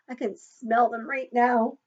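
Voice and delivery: normal talking voice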